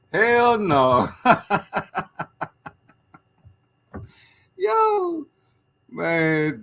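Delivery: real laughter at a viewer's comment; laughing. Real, not acted. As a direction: crack up at the joke. Bursts out laughing.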